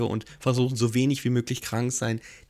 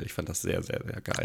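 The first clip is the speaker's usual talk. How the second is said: leise, gehauchte Begeisterung; verzückt flüstern; geflüstert; 7 semitones lower, 7 dB quieter than usual. Real, not acted. Schwärmt flüsternd vom Musical. (flüsternd schwärmen)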